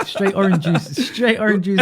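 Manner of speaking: high, loud laughing protest. Real, not acted. Denies drinking, laughing.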